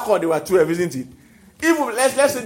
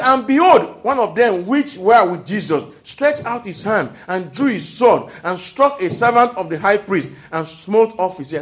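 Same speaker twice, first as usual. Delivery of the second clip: slow, weighty Bible reading; scripture reading; 5 dB louder than usual. Acted. Preacher reading scripture to the congregation, slow and solemn. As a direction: read the verse slowly and solemnly.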